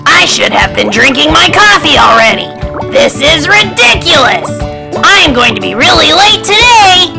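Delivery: loud, high storybook outburst; indignant yelling, pitch rising as it goes; exasperated rant. A narrator's reading. Grumpy goose rants over his coffee.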